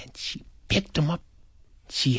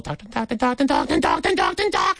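endless talking; 8 semitones higher, faster than usual. A narrator's reading. Mimics aunt's endless talking.

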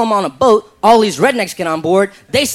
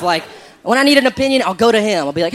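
normal talking voice

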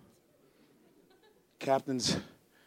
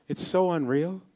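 ordinary talk

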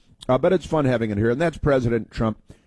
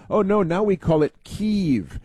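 higher sneering imitation saying Kyiv as keev; lightly voiced snooty media people; mocking the media; 8 semitones higher than usual. Host mimics media people correcting his Kyiv with a sneer. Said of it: "Democrats told us".

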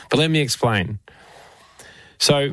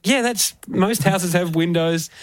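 sarcastic; 7 semitones higher than usual. Retold or imitated. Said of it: "And I was like".

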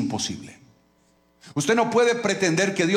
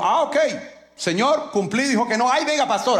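mock disappointed giver; 8 semitones higher than usual. Preacher mocking a giver who takes no for an answer, high mock whine. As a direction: play the giver relieved too fast, pitched way up.

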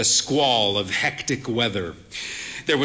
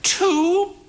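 put-on higher, slow voice of a proud frail old lady; frail old mother; 3 dB louder than usual. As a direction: play the bird-faced old mother, cautious.

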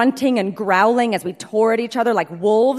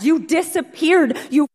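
high, loud, upset outburst; upset shout; 5 semitones higher, 5 dB louder than usual. Retold or imitated. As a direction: blurt it out, hurt and loud. Jilted groom confronts returned bride.

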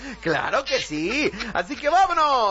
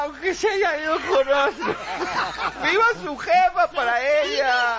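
put-on high, emotional drunken holler; tipsy outburst; 6 semitones higher than usual. Drunk official cheering for his ex, hollering. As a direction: lose the thread, still cheering. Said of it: "un poquito pasado de copas".

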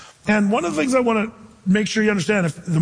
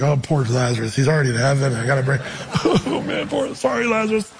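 mock pity; 5 semitones lower than usual. Jesus pitying Lazarus in heaven, laughing lower voice. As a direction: lower voice breaking into a chuckle. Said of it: "He's like".

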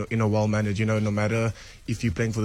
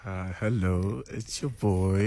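sexy voice; 2 semitones lower, 3 dB quieter, slower than usual. Real, not acted. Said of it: "using their sexy voices on radio".

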